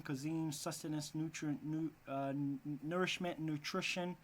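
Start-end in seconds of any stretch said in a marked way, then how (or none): none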